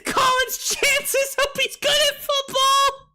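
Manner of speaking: high, breathless laugh-talking; laughing hard. Real, not acted. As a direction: talk through uncontrollable laughter. Laughing at own joke.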